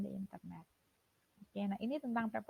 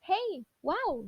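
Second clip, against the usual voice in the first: high, loud amazed cry; excited wow; 8 semitones higher, 4 dB louder than usual. Shown as a demonstration. Teacher shows an interjection to students, amazed. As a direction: sound truly wowed.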